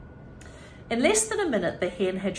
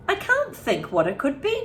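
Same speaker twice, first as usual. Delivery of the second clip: put-on puzzled boy; 7 semitones higher, 4 dB louder than usual. A narrator's reading. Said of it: "George said".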